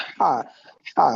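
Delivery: হা breathed from a wide open throat. Shown as a demonstration. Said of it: "হ উচ্চারণ করার সময় পুরা মুখে গলা". (গলা খুলে ভেতর থেকে হা)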